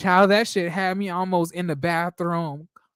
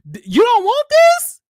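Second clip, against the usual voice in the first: much higher, louder mock sweet voice to the dog; squeaky teasing; 10 dB louder than usual. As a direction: tease the dog in a high squeal. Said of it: "I was like".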